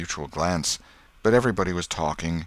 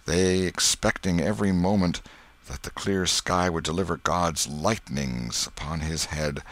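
ordinary delivery, nothing marked

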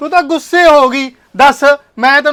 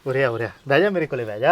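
sleazy coaxing; 12 semitones lower, 9 dB quieter than usual. Acted. Shopkeeper luring her to sit.

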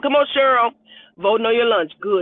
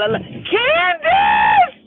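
much higher, slow and loud; excited exclamation; 4 dB louder, much slower than usual. Real, not acted. Shouting love to Candace in the comments, gushing. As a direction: gush it out.

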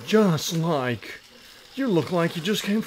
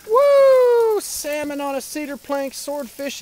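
much higher and louder whoop; excited whoop; 11 semitones higher, 6 dB louder, slower than usual. Real, not acted. Dad presenting grilled dinner, excited whoop. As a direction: show off the food with glee.

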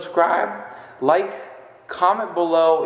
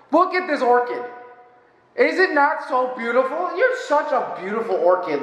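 higher, gushing over "orchid"; gushing at a plant; 8 semitones higher than usual. Pretend. Pretending to rant at his orchid, higher gushing voice. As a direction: swoon over the flower. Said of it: "I could have something like".

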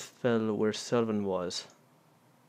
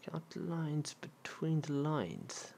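slow reading; 4 semitones higher, 8 dB quieter, much slower than usual. Real, not acted. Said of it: "slow puzzled reading".

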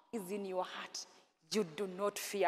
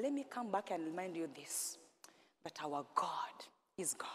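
hushed, intimate whisper, much softer; 12 semitones lower, 24 dB quieter than usual. Real, not acted. Preacher reminding the church of God's greatness, whispered. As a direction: breathe it to them like a secret.

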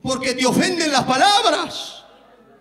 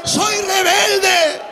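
pleading woman; 4 semitones higher, much slower than usual. Acted. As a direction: cry out humbly, drawn out and high like the begging woman. Preacher voicing the humbled woman.

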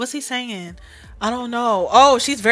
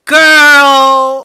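loud drawn-out outburst; shocked exclamation; 3 semitones higher, 12 dB louder than usual. Real, not acted. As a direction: draw it out loudly in disbelief. Shocked reaction to lice news.

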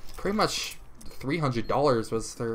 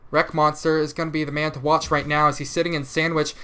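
ordinary delivery

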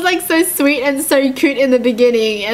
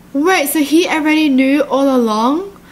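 plain reading voice

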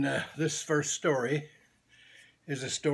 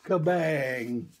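usual speaking voice

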